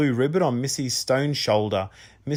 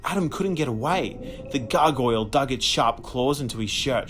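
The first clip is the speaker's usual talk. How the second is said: ordinary delivery, nothing marked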